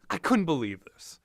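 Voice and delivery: ordinary talk, nothing marked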